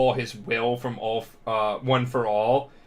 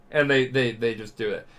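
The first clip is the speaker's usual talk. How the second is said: ordinary delivery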